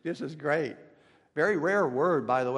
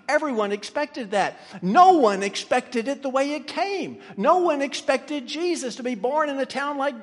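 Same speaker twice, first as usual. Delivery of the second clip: higher, driving pulpit cadence; impassioned preaching; 8 semitones higher, 6 dB louder than usual. Real, not acted. Preacher proclaiming the mysteries of salvation, impassioned. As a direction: build with ringing, repeated emphasis.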